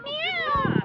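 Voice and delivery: high, loud meow; cat meow. Pretend. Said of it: "What does a cat say?".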